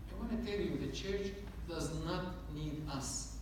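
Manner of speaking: ordinary talk, nothing marked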